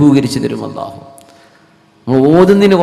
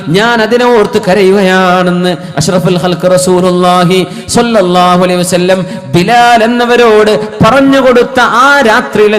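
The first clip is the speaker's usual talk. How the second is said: sustained, projected sermon delivery; preaching; 5 semitones higher, 3 dB louder than usual. Acted. Preacher warning against reciting without reflection, preaching. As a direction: press the warning on a congregation, phrase by phrase.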